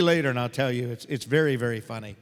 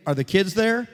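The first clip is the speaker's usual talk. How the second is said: raised voice, calling down the line; projected question into the phone; loud phone question; 4 semitones higher, 6 dB louder than usual. Real, not acted. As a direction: ask loudly so the listener hears.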